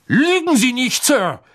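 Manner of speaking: high, forceful accusation; furious shout. A narrator's reading. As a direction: cry out in outrage. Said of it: "rief Mister Crouch".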